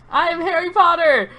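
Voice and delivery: high, loud proud yell. Acted. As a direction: shout it to the whole castle. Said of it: "began yelling".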